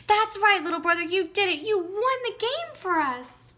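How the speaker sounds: high, loud, fast celebration; excited cheer. A narrator's reading. Big brother celebrating the win, excited cheer. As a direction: cheer him on, proud and loud.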